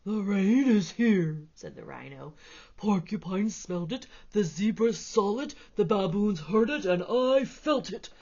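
a big beast declaring; rhino. Acted. Rhino character voice.

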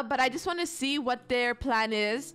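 usual speaking voice

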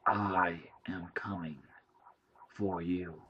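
slow whispered threat from the entity; slow creeping whisper; menacing alien whisper. A narrator's reading. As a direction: breathe it like a threat in the dark. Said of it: "the screen said".

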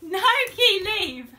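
a loud "No, Key" that cracks into laughter; scolding the dog while you can't stop laughing; laughing shout. Real, not acted. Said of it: "I know I'm laughing".

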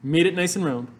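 ordinary delivery

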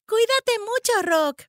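high, called-out goodbye; girl's loud, fond send-off; farewell shout. A narrator's reading. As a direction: call up to a departing bird. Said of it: "gritó Annie".

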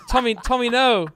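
high, loud, desperate calling out; pleading shout. Pretend. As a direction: beg Tommy to stop, shouting high. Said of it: "I'm like".

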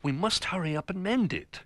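high, small anxious bear voice; worried Little Bear. Acted. Little Bear upset about the chair, high and anxious. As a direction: urge the others with childlike worry.

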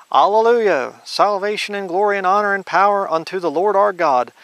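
alleluia rung out high and loud; jubilant proclamation. Acted. Preacher voicing heaven's crowd, jubilant and loud. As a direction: lift it like a crowd praising in heaven.

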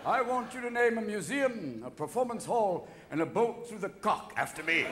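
a dying prince sending his wife off with grand gestures; hammed-up dying Prince Albert. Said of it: "I'm sure Albert said".

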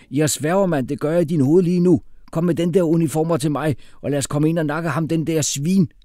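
high, loud street-tough threat; hot-headed street youth. Acted. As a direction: rant like an eager young hothead.

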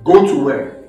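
low, firmer "go to where"; firm challenge. Acted. Prince challenging the offer to leave, firm challenge. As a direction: put force behind "go to where". Prince repeats it more forcefully.

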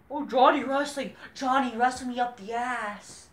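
high, loud mock swoon; a hammed-up fan gushing over a wrestler, high; mock moaning fan. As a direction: parody an infatuated fan. Mocking fans' fantasy.

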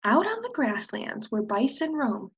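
plain reading voice

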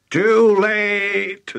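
high, loud, sing-out satisfaction; gleeful verdict; pleased. A narrator's reading. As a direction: call it out with obvious pleasure. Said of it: "looking pleased".

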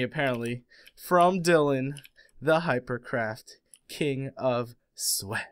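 ordinary delivery

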